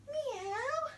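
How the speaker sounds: teacher meowing during cat sit-ups. Pretend. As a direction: mew like a little cat. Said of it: "our cat sit-ups".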